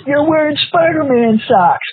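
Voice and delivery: high, teasing schoolboy jab; mocking classmate. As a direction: imitate the kid calling out the socks. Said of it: "one of the guys sitting next to me goes".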